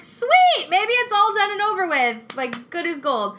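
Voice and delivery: high, loud happy burst; excited relief. Retold or imitated. Mother hearing her baby is fine, high excited relief. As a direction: squeal with relief that it's over.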